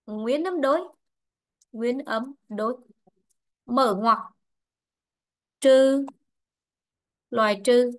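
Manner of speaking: slow dictation, a few words at a time; dictating. Real, not acted. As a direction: pause after each short phrase for note-taking. Teacher dictates the next rule. (đọc chính tả chậm, ngắt từng cụm)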